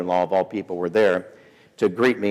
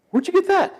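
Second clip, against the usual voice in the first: very high-pitched imitation of a wife; mock suspicious question. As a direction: demand it in a squeaky put-on voice.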